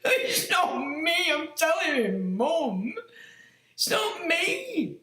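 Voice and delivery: high, slow, drawn-out protest; mock pleading with his mum; mock whiny denial. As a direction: whine it like a sulky kid caught out. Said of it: "I'm like".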